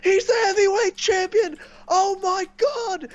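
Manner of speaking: high, far-off screaming of pure joy; ecstatic screaming. Retold or imitated. Fan reliving the title win for his friend, wild screaming. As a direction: shriek it out like your champion just won.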